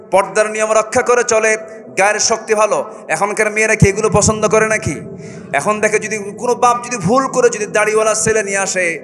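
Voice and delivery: high, loud sermon chant; full-force preaching. Acted. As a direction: preach it to the crowd.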